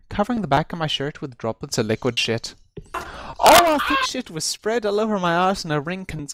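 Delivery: ordinary delivery, nothing marked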